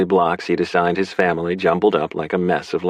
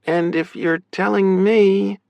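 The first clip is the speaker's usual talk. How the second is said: high voice, slowing on "me"; high sister voice trailing into an unfinished thought; drifting off; 13 semitones higher, slower than usual. A narrator's reading. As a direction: stretch "me" out and leave it hanging. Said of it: "she drifted off".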